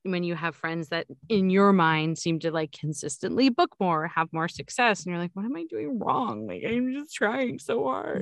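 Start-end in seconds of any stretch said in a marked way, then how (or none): 5.3-8.2 frustrated inner voice. Retold or imitated. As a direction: plead as if to yourself. Quoting own discouraged inner voice.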